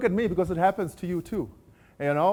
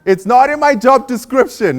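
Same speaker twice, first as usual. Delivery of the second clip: mocking phrase quote; 7 semitones higher, 9 dB louder than usual. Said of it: "you've heard the phrase".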